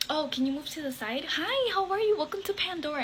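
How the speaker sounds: high, sugary customer-service voice; mock the clerk's fake niceness, way up high; fake sweet clerk. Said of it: "she completely changed her vibe".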